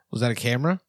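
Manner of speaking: normal talking voice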